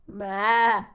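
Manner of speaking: a bleating maa in another voice; goat bleat. Acted. Reading to kids, the goat bleats at Duck. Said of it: "said Goat".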